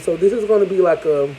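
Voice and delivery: ordinary talk, nothing marked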